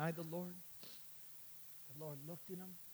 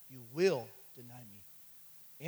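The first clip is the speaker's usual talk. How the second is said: slow, grave quote of Jesus; solemn prophecy; 4 semitones lower, 6 dB louder, much slower than usual. Retold or imitated. Preacher quoting Jesus to Peter, slow and grave. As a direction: let each word land slowly.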